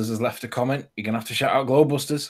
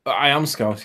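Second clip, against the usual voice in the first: usual speaking voice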